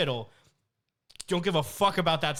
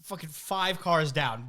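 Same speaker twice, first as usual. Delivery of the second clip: usual speaking voice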